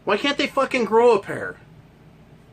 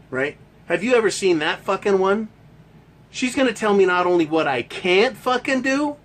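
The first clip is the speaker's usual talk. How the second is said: ordinary talk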